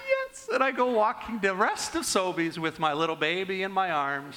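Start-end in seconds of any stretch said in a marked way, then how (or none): 0.1-0.5 a much higher, victorious yes; triumphant yes. Retold or imitated. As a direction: cheer the win. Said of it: "And I'm like".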